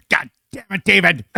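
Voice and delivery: high-pitched mock-angry scolding; imitating furious mom. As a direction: scold him like an outraged mother. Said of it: "she was so mad. She goes".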